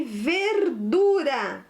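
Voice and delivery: "verdura" drawn out syllable by syllable; slow dictation. Shown as a demonstration. Teacher gives pupils the next word, slow dictation. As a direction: say "verdura" slowly, each syllable apart.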